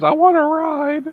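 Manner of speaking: high, slow mock quote; quoting him. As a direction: squeak it out slowly like a cartoon. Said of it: "Last thing he heard was".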